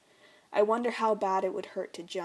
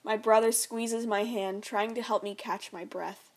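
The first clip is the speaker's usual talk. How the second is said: plain reading voice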